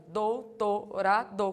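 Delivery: slow, "Doutorado" split into dou-to-ra-do; teacher drilling "Doutorado" syllable by syllable. Shown as a demonstration. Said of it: "Separa a sílaba de doutorado".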